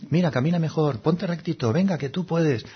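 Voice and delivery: light encouraging nudge. Pretend. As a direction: coach gently, like cheering someone on. Encouraging correction, told kindly.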